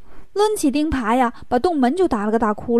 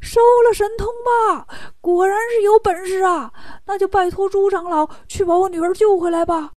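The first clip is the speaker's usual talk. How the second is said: high-pitched, amazed exclamation; astonished king; 6 semitones higher than usual. Acted. Amazed king asks Pigsy to stop his magic, astonished. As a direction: call out in startled admiration. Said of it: "国王大吃一惊".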